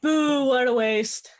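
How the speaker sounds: loud, drawn-out jeer; playful loud heckle, stretched out; mock booing. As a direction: boo it slowly and loudly, like a disappointed heckler. Player jeers at the outcome.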